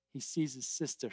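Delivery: ordinary delivery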